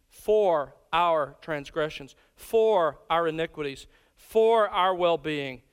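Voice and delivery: slow, deliberate, each "for" hit and held; slow repeated stress on the word "for". Shown as a demonstration. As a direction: lean on every "for" to show the preposition. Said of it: "Notice the prepositions".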